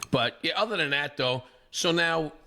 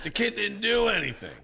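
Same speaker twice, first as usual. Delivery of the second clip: ordinary talk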